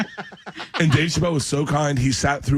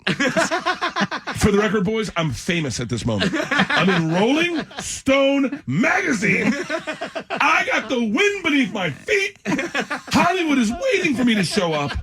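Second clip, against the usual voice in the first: high, slow, laughing boast; laughing mock brag; 11 semitones higher, slower than usual. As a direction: puff yourself up through laughter. Mocking his cocky younger self.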